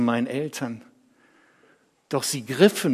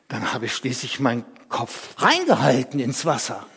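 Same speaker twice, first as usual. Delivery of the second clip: ordinary delivery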